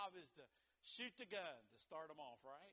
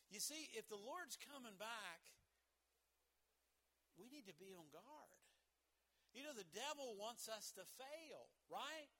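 ordinary talk

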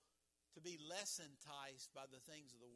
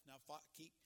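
ordinary talk

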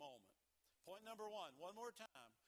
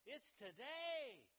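slow, loud, high today; today hammered out; emphatic today; 6 semitones higher, 4 dB louder, much slower than usual. Acted. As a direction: land on today. Preacher climax.

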